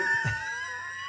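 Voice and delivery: high squeal of a laugh; burst of laughter. Real, not acted. Laughing at the mentor who hung up on him.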